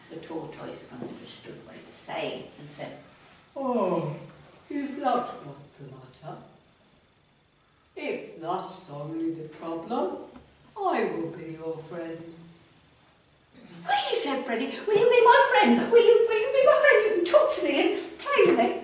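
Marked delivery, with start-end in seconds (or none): 3.6-12.2 put-on lower, slow and gentle old tortoise; tortoise offering to be the frog's friend; slow kind tortoise. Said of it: "Tortoises talk like that". 13.8-14.1 very high delighted squeal; overjoyed frog. Acted. Freddy overjoyed. 14.9-18.7 high, loud, fast, giggly gushing; overjoyed frog. Acted. Freddy overjoyed.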